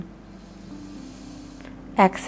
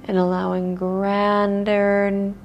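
guided meditation; 4 dB quieter than usual. Acted. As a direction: lull them into stillness. Leads a grounding meditation.

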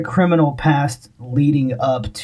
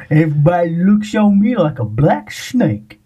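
country accent; 3 semitones higher than usual. Shown as a demonstration. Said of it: "I said it in a country accent".

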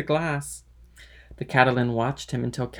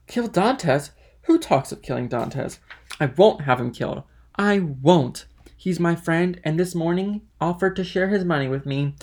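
louder, higher, stubborn refusal; vehement protest; 3 semitones higher, 4 dB louder than usual. Acted. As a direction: object forcefully on a friend's behalf. Drunk Caderousse protests against killing.

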